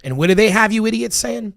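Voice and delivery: incredulous angry rant; rising angry rant. Real, not acted. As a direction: throw the question out in disbelief. Escalating rant.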